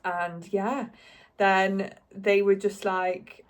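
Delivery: ordinary talk, nothing marked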